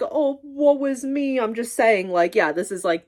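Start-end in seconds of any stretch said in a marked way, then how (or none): 0.0-1.4 high, drawn-out mock lament; mock self-pity. Speaker disowning any self-pity, mock lament. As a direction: wail it slowly in mock woe. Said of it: "I'm not saying this is like".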